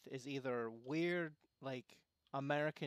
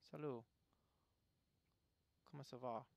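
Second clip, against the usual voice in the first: French accent, spoken a touch softer; French greeting; 7 dB quieter, faster than usual. Shown as a demonstration. Player greets in French while testing accents, French accent. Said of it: "pay attention to the sounds people make".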